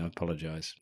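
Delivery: usual speaking voice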